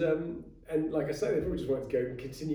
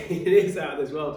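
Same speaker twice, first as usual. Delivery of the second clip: louder, laughing through the words after a sip goes down wrong; recovering with a laugh, louder; laughing after choking; 3 semitones higher, 11 dB louder than usual. Real, not acted. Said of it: "I know I'm choking on it".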